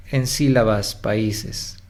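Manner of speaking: ordinary talk, nothing marked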